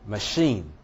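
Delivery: "machine" with a schwa, then strong CHINE; stress on second syllable. Shown as a demonstration. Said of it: "does not take stress".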